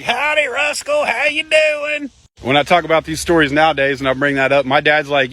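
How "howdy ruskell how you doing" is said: much higher, slow, sugary greeting; cheery grandpa greeting. Retold or imitated. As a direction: switch to fake friendliness, high and slow. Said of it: "he's like".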